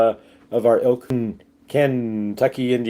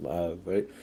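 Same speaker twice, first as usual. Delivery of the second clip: usual speaking voice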